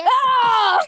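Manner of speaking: high, loud yelp of joy; triumphant shout. Real, not acted. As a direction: cheer out loud. Cry shout while gaming.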